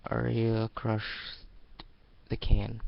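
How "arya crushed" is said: ordinary delivery, nothing marked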